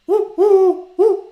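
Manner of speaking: very high, loud cooing woo; wood pigeon coo. Shown as a demonstration. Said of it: "Wood pigeons go".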